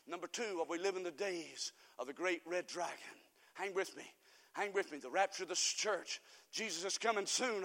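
low, soft, drawing listeners in; quiet, deep pulpit aside; hushed preaching. Real, not acted. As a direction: drop right down to hold their attention. Drops voice for next point.

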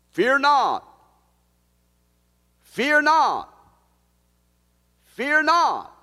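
high, loud, stretched cry; ringing proclamation. Acted. Pastor crying God's words over his congregation, loud. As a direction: shout it out to lift the fear.

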